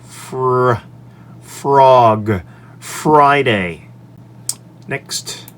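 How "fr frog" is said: fr isolated, then frog with the fr hit loud; phonics sound fr. Shown as a demonstration. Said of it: "F R makes a fr".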